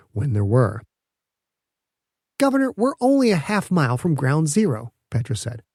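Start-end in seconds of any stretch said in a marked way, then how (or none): 2.4-4.9 put-on young inventor, eager and higher; bright young scientist explaining proudly; Petra's voice. Petra urges governor onward.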